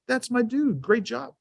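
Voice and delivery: beam at him like a proud dad; proud praise. Retold or imitated. Dad praising his son after the drill, high and proud.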